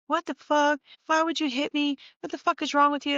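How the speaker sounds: mock outrage at being punched and left outside; pissed coworker. Coworker storms in after being punched, demanding answers angrily. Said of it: "she's fucking pissed".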